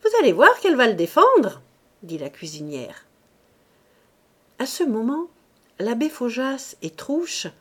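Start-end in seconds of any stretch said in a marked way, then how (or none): 0.0-1.6 fast, much louder and higher taunt; jeering cook. A narrator's reading. Cook mocking Marthe for defending him, loud fast jeer. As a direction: jeer at the mistress.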